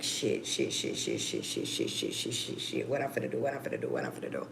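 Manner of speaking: frantic whispered cursing under the breath; panicked whisper. Retold or imitated. Mother fearing for her missing son, panicked whispering. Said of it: "I was like".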